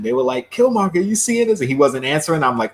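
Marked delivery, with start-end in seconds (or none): 0.5-1.6 raised, higher-pitched mock call. Retelling how they called Killmonger, calling out. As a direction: call to him as if checking in. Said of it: "when they were calling him".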